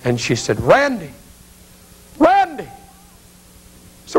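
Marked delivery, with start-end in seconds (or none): 0.6-2.7 high-pitched calling out, climbing higher; yelling. Retold or imitated. Mother yells to son across the mausoleum, high-pitched call. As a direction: call the name across a great hall. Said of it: "she yelled at me".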